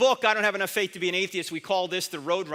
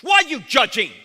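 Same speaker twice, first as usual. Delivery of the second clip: loud, higher, indignant jab; annoyed critic; 6 semitones higher, 9 dB louder than usual. Retold or imitated. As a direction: fire it back like an offended critic. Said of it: "if they really get annoyed with you".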